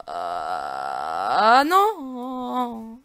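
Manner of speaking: long rising wail of "no"; anguished drawn-out cry; dismayed scream. Real, not acted. Dismay at dying in game.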